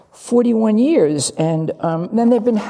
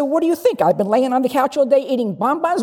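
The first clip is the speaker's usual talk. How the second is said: higher, biting mock sarcasm; sarcastic retort; 5 semitones higher, faster than usual. Husband snapping back at wife over supper, high and sarcastic. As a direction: throw the sarcasm right back. Acted quarrel, sarcastic reply.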